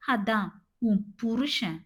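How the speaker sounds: புருஷன் pressed out with held-in anger; stress on புருஷன். A narrator's reading. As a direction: lean pointedly on புருஷன். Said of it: "புருஷனில் அழுத்தம் கொடுத்தவன்". (புருஷன் என்பதில் அழுத்தம்)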